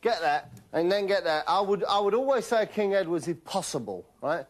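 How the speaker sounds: ordinary talk, nothing marked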